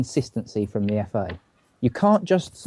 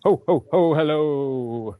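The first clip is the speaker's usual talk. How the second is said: Santa ho ho ho; 4 semitones higher, much slower than usual. Acted. Santa laugh greeting.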